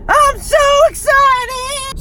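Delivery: very high, loud, drawn-out squeal; hammed-up excitement. Vlogger to camera before the sales, hammed-up excited squeal. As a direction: squeal it slowly in over-the-top excitement. Repeats the words in exaggerated excitement.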